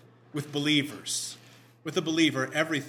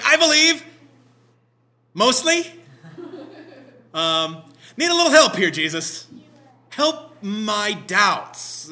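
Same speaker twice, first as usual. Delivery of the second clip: much louder and higher, half-joking plea; pleading paraphrase; 4 semitones higher, 9 dB louder, slower than usual. Acted. Father admitting doubt to Jesus, loud pleading. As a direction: beg for help with a wry edge.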